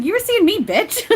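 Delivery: high, loud, laughing mock indignation; playfully offended. Medium repeating Eric's teasing line, playfully offended. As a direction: squeal it as teasing outrage. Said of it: "He's, like, playfully offended".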